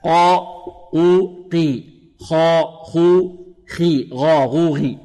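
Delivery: slow separate syllables from heavy قا through rounded قو to least-heavy قي; graded heaviness drill. Shown as a demonstration. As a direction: chant each triplet like قا، قو، قي, easing the thickness. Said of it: "هكذا".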